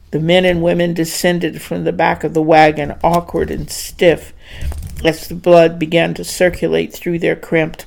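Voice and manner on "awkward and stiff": plain reading voice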